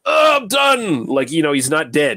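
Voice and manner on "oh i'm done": cartoonish biker groan. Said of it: "you hear the biker go".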